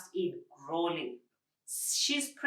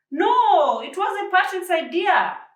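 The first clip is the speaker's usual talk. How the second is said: protesting; 11 semitones higher, 10 dB louder, slower than usual. A narrator's reading. George protests to Josephine.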